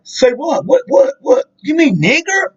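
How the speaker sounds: put-on high, incredulous old man, pitch jumping up; mock feigned ignorance. Old man at the barbecue playing innocent, high and incredulous. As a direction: squeak out the question like he can't believe it. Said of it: "And he was like".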